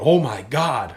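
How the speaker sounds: loud shout of disbelief, a little put-on; retold shout. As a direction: call it out like he just saw the deer. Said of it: "he screamed out".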